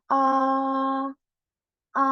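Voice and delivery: level high A held flat; first tone A. Shown as a demonstration. Teacher demonstrating tone one, high and level. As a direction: hold A high and flat, no change. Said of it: "itu lurus terus ya".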